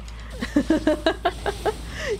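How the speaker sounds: high-pitched burst of laughter; laughing. Real, not acted. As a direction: crack up while telling the story. Laughing at friend's failed impression.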